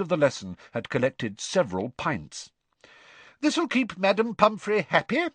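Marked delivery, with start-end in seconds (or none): 3.4-5.3 high, brisk schoolmistress; bossy teacher, pitched high. A narrator's reading. Voices Professor Sprout.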